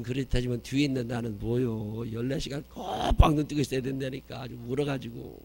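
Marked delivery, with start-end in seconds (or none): none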